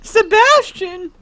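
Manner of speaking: high, loud, drawn-out wail; mock anguished cry. Friend wailing a name for laughs, loud mock cry.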